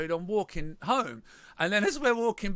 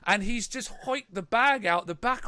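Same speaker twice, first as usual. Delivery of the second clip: ordinary talk, nothing marked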